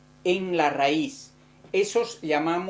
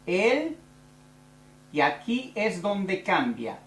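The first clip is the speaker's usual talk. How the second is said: slow dictation; 2 semitones higher, slower than usual. Shown as a demonstration. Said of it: "yo lo voy a hacer".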